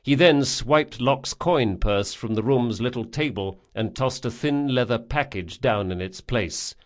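plain reading voice

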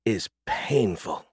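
hushed, slow aside; whispered. A narrator's reading. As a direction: say it slowly under your breath. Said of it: "she whispered".

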